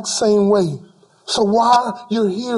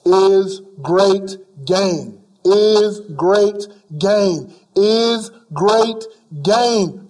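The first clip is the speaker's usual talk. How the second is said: slow emphatic repetition; 3 semitones lower, much slower than usual. Acted. Preacher repeats scripture slowly.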